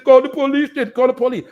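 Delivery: imitating a gas station clerk yelling to call the police; mock yell. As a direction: throw it back at him like the angry clerk daring you. Retold attendant yelling back at him.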